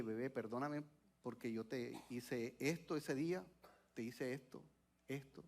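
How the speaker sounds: hushed, low retelling of a tearful apology; soft, subdued confession to a child; retold tearful apology. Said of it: "Y yo llorando".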